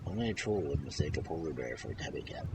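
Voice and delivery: ordinary delivery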